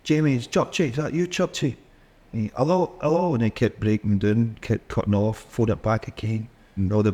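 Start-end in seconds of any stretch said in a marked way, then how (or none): 0.0-1.8 raised pitch, checking who's there; higher-voiced phone hail; calling down the line. 2.6-3.3 high, repeated phone hello; higher-pitched, trying to be heard; calling down the line. Acted. Phone call keeps cutting out.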